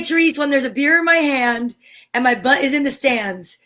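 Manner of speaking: ordinary talk, nothing marked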